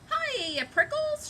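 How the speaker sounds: shouted. Acted. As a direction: holler it bouncily, way up high. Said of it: "she shouted".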